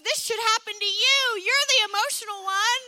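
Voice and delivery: high, loud, fast accusing jab; mocking husband. Husband blaming wife, imitated high, loud and fast. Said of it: "he looks at me and he goes".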